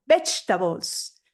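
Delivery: vegetables slow, in two syllables, VECH-tabols; correct English pronunciation. Retold or imitated. Teacher in England modelling the word, slow two-syllable vegetables. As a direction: stress the first syllable of vegetables, swallow the middle. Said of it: "dos sílabas".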